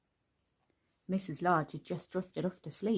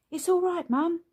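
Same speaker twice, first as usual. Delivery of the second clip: put-on much higher, louder boyish reassurance; reassuring boy; 11 semitones higher, 7 dB louder than usual. A narrator's reading. Lester looking in on his mum, high boyish reassurance. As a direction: soothe mum like an eager young son. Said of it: "he reassured her".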